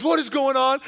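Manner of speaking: a mock-panicked wife rushing upstairs; retold panic. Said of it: "she's panicking".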